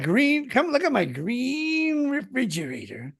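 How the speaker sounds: high, put-on old-timer voice; old guy. Co-host mocking the old guy, high put-on voice. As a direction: stretch it out as a comic old codger. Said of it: "I'm that guy".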